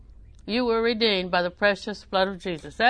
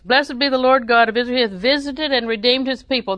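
a touch louder, declaiming the verse; scripture reading; 3 semitones higher, 5 dB louder than usual. Acted. Preacher reading verse to the congregation, declaiming. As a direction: read it out like holy writ.

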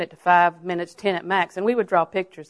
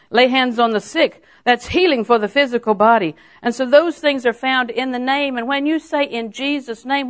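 raised, forceful declaration climbing in pitch; building preaching; 5 semitones higher, 6 dB louder than usual. Real, not acted. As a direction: proclaim each point to the congregation, building.

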